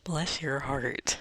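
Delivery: hammed-up Southern sweetness; mock Southern belle. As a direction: play a syrupy Southern lady, stretching every word.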